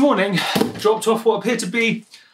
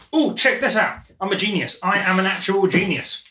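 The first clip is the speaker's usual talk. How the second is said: normal talking voice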